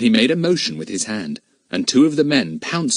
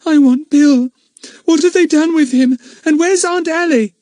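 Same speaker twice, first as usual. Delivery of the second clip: put-on frightened girl; 8 dB louder than usual. A narrator's reading. Frightened girl asking for family.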